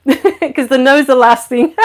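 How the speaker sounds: real amused laugh through the words. Real, not acted. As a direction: laugh as you talk, tickled by it. Amused by the Aussie saying.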